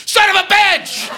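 high yelled "Son of a bitch!"; panicked shout. Acted. Mimicking the panicked nation, high alarmed shout. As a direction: yell it like the whole country is panicking. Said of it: "the whole goddamn country was".

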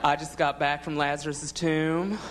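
slow Southern drawl, mock effeminate; Southern accent, effeminate and slow; effeminate Southern Jesus. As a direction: play Jesus as a campy Southern guy. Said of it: "Think of Jesus going".

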